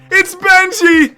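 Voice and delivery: slow, high, loud cry of delight; thrilled shout; excited shout. Real, not acted. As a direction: shout his name in disbelief. Surprised at spotting Simmons.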